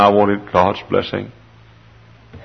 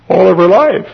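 sudden high, loud lament; anguished outburst; 10 semitones higher, 6 dB louder than usual. Acted. Father grieves his leper daughter's whole life, crying out. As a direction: burst out on the phrase in anguish.

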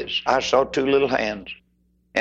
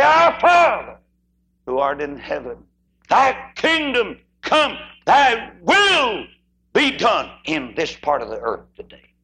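fervent prayer; 5 semitones higher, slower than usual. Acted. Boys giving their hearts to Christ, praying fervently. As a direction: lift the prayer up.

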